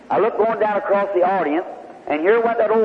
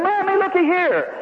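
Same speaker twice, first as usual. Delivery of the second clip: put-on much higher, a small child calling her mother; healed little girl calls to her mommy, child voice; little girl's voice; 12 semitones higher than usual. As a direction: squeak it out like a delighted little girl. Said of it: "and the little girl said".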